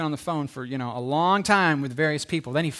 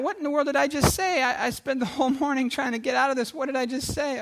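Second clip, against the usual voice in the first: high-pitched, acted bewilderment; baffled disbelief; 7 semitones higher than usual. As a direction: question yourself in mock exasperation. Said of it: "He can't figure it out".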